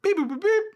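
high, loud chirpy robot beeping; robot beeps. Retold or imitated. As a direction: beep it out like a cartoon robot. Imitating robot noises.

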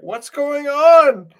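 high, loud confused yell; slowed, shrieking what's going on; mock shout. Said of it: "'cause I was like".

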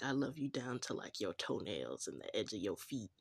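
lightly voiced low crooner talk; imitated sweet-talking lover. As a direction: drop low for playful sweet nothings. Voices his love song words.

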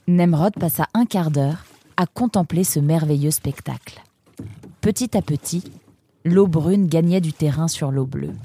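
ordinary delivery, nothing marked

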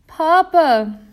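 high, loud scream for her father. A narrator's reading. Little girl in the elevator screams for her father, terrified. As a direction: shriek for Papa in terror. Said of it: "she screamed".

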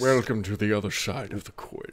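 cool podcast host voice. Shown as a demonstration. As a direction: pitch the intro low and ominous like a sci-fi show. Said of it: "a cool voice for it".

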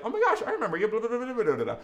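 high, gushing reunion squeal; high excited "oh my gosh, I remember you". Retold or imitated. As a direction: gush like old friends reconnecting. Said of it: "They're like".

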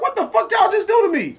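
high stunned outcry; shocked outcry. Retold or imitated. Asking the testers what they did, high stunned outcry. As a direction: cry out at the nurses. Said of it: "You be like".

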